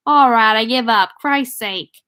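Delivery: loud, comic surrender; amused cry. A narrator's reading. Richie gives up under the teasing, crying out in mock protest. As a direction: throw it out as mock exasperation. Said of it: "Richie cried, laughing himself".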